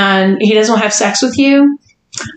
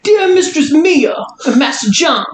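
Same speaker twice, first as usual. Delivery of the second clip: put-on light Jersey accent, a bit higher; 5 semitones higher than usual. Hostess reading a listener's email in a Jersey accent. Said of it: "Doing a Jersey accent of a man".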